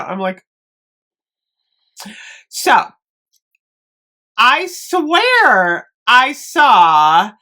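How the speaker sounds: usual speaking voice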